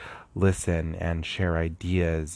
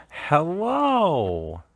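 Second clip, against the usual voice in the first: high, loud, stretched-out hello; bright called hello; 11 semitones higher, 4 dB louder than usual. Acted. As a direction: sing out a cheery hello. Playful greeting after testing.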